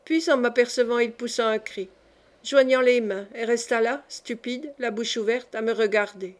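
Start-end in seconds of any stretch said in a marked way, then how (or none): none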